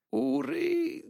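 high pleading call. A narrator's reading. Mrs Heep pleads with her son. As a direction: beg him gently. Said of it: "poczęła błagająco pani Hip".

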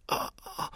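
soft unvoiced groan of pain; faint groan of a wounded man; pained moan. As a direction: let out a weak moan.

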